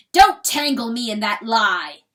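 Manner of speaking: loud, furious rebuke; raging. A narrator's reading. Rooster raging at hen, furious. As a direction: spit it out in rage. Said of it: "Now he is raging".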